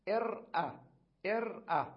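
ارأى said high with initial hamza; demonstrating form. Shown as a demonstration. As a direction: sound out ارأى with the connecting hamza. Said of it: "ماذا أقول". (نطق ارأى بهمزة الوصل)

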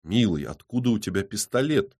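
ordinary delivery, nothing marked

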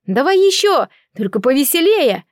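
high, eager crowd request; calling out. A narrator's reading. Party guests egging on the singer, calling out. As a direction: call out for another song, pitch lifted. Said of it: "и стали кричать".

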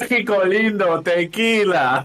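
high, slow mocking sing-song; mock Mexican cliché voice. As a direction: ham up the tourist cliché, drawn out. Amigo parodia al francés. (imitando con burla un tópico mexicano, alto y lento)

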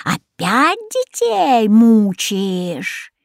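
slow stern rebuke, drawn out; a wise goose scolds the witch; slow menacing goose. A narrator's reading. Goose scolding the witch, slow and stern. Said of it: "грозно зашипела она".

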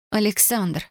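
plain reading voice

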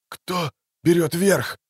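slow slurred wounded voice; slurred speech. A narrator's reading. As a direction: mumble through the haze. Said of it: "хоть и не совсем членораздельно".

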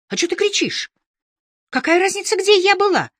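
high-pitched indignant protest; high, agitated comeback rising in volume; defensive retort. Acted. Heroine snaps back in quarrel.

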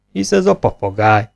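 plain reading voice

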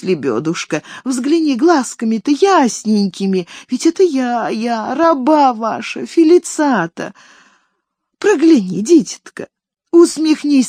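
pleading lament. Acted. Nanny begging the fainted girl to wake, high and tearful. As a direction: beg her to wake, wailing.

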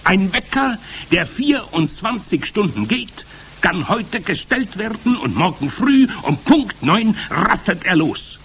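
high, loud witch voice; commanding Grand High Witch. A narrator's reading. Witch leader starting to explain her recipe, commanding.

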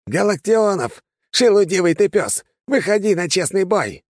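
frantic high furious yell; hysterical shouting. A narrator's reading. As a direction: scream the challenge in rage. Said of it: "истерично закричал Рамашир".

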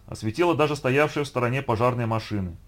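ordinary delivery

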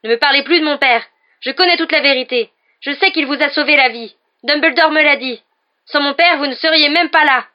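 high, very loud, sustained rage; furious outburst. A narrator's reading. Harry furiously shouts at Snape about his father. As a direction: throw every sentence at him.

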